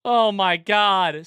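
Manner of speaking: stunned exclamation. Real, not acted. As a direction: let it out slowly in disbelief. Shocked after dying.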